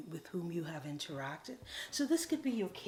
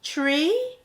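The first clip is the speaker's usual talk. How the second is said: much louder and higher, speaking up; piping it up; 8 semitones higher, 14 dB louder than usual. Shown as a demonstration. Coach shows how to speak up to a tree, loud and high. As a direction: declare yourself to the tree. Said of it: "you just try piping it up".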